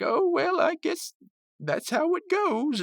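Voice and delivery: put-on a slow, lilting high old fellow shrugging it off; imitating Pops.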